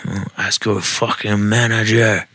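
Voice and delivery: hammed-up French accent, unhurried; improved French accent. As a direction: rehearse the French chef's line without screaming. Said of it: "that was a little better".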